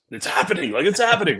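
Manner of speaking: hammed-up excitement; excited intel officer. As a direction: play someone giddy that it's finally happening. Acting out the thrilled intel officer.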